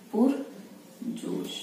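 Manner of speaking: पुरजोश drawn out slowly in a near whisper; slow soft dictation. Shown as a demonstration. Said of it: "शब्द आ रहा है पुरजोश". (पुरजोश को धीरे फुसफुसाकर बोलना)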